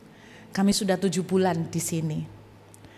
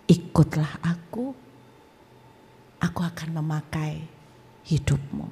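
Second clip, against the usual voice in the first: voice of Jesus; 5 semitones lower, 3 dB quieter than usual. Retold or imitated. Jesus calling the young man to follow, low and solemn. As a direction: softly summon with long pauses. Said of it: "Lalu dia berbicara".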